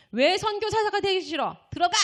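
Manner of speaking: high, loud retold rebuke; scolding pastor. Pastor smacks the student's head, mock scolding. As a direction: scold and dismiss, acting the pastor. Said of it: "제 머리를 딱 때리시더니".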